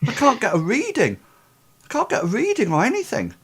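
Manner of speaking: high-pitched medic, at a loss; medic character. Acted. Game master voices frustrated medic.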